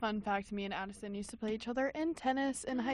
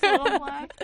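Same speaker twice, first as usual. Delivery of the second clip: louder, higher playful repeat; hyped teasing; 6 semitones higher, 8 dB louder than usual. Real, not acted. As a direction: hype it up like a cheer.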